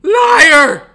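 loud, high cry of "Liar!"; outraged retold shout; shouted accusation. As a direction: yell it at her like an accusation. Accusing the nurse loudly.

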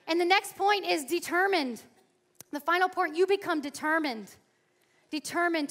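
loud, high fervent preaching; rally the room like a preacher; full-force sermon climax. Acted.

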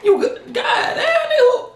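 high, laughing whoops of delight; real excited reaction, laughing high; hyped laughing. Real, not acted. Reacting to a hard verse, laughing and hyped.

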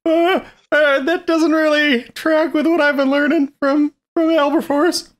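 put-on high, nervous young apprentice. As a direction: answer back like an unsure student. Playing apprentice Wilbur in reply.

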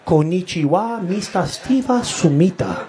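put-on lightly accented airport announcer, Steve Smith becoming Sumita; a hint of a Japanese accent, stretched syllables; Japanese-style announcement. Airport announcer mangling the name into Japanese, light accent. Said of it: "the same voice said".

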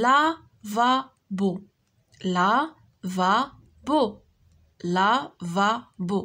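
"Lavabo" very slowly as la, va, bo; syllable by syllable. Shown as a demonstration. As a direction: say "Lavabo" in three beats with gaps between. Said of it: "Frappe les syllabes".

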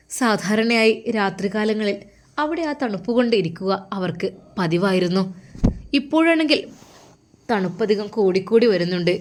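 ordinary delivery, nothing marked

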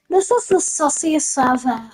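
ordinary delivery

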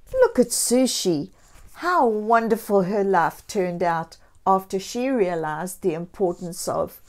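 ordinary delivery, nothing marked

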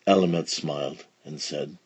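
plain reading voice

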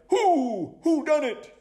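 high, loud hooting; high-pitched owl detective outcry; owl's cry. Acted. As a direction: hoot it out in frustration. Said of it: "Hubert cried".

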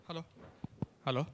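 ordinary talk, nothing marked

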